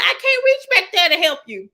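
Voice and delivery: high, loud mock protest; exasperated parent. Driving parent answering kid in back, loud high exasperation. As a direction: raise pitch and volume like a harried driver.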